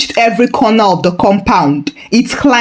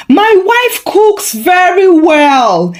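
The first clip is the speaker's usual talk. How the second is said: slow, high, proud boast; husband showing off his wife's cooking; proud announcement; 9 semitones higher, slower than usual. A narrator's reading. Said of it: "he announced proudly".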